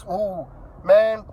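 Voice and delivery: usual speaking voice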